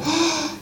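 high, soft gasp of wonder; quick acted gasp, amazed; awed gasp. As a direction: imitate a breath caught at something brand new. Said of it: "I think we all go".

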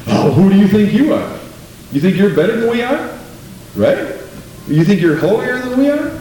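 high, scornful taunt, pitch climbing; sneering mockery. A sinner taunting his converted friend, high sneering voice.

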